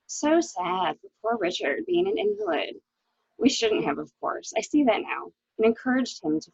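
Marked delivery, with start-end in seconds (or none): 0.1-2.8 mock sympathy. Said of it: "you know what one says".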